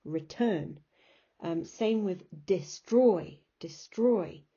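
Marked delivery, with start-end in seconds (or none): 0.0-0.8 reTURN, weight on the second syllable; stress on TURN. Shown as a demonstration. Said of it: "The turn is the stress bit". 2.5-4.4 deSTROY, second syllable exaggerated; stress on STROY. Shown as a demonstration. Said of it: "is the stressed bit".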